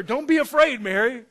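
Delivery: lightly played angel, urgent and high; angel's reassurance. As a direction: call out a reassurance to someone panicking. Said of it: "He's like, the angel told her".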